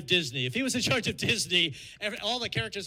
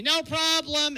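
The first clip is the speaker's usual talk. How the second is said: yelled reply; 8 semitones higher, 4 dB louder, slower than usual. Retold or imitated. Wife yelling back down.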